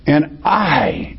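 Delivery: "I" held long after a light "And"; emphatic stress on I. Real, not acted. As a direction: land firmly on "I" and let it fall.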